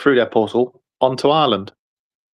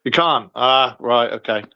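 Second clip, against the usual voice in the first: usual speaking voice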